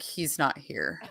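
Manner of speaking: soft hushed mimic of her; quiet imitation. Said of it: "she's like".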